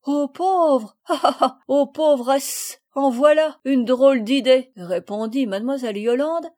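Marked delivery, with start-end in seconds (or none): 0.0-4.7 loud, high, scoffing laughter through the words; hammed-up laugh at a ridiculous idea; bursting out laughing. A narrator's reading. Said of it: "riant aux éclats". (en riant aux éclats)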